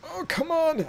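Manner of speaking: high-pitched complaint; exasperated protest. Real, not acted. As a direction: protest at the repeat pull. Annoyed at a repeat pull.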